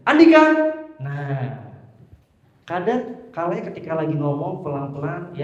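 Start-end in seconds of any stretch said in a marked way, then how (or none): 0.1-0.4 calling a name out high and loud; a loud, high, spirited call; excited high call. Shown as a demonstration. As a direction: shout it with lots of energy. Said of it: "Kadang semangat banget tuh, ya, tinggi".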